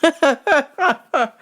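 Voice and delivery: high, loud giggle; real high-pitched laugh; burst of laughter. Real, not acted. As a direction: crack up at your own bad accent. Cracks up at own accent.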